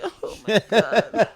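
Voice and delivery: slow, loud, laughing fake wail; laughing mock wail. A girlfriend wailing over her dead ex, laughing. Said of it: "And she's like".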